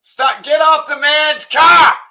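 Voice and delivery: screaming at the screen in outrage; disgusted furious yelling at a video; furious shout. Real, not acted. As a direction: yell it at full force, enraged.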